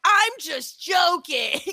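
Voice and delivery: high, drawn-out whiny shout; mock protest. Groper excusing himself to someone hitting him, mock wailing.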